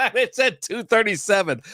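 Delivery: talking through a laugh. Real, not acted. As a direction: say it while still laughing. Laughing while reading scale weight.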